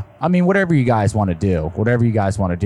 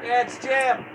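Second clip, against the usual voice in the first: far from mic; 12 semitones higher, 7 dB quieter, slower than usual. Real, not acted. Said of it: "one more time?".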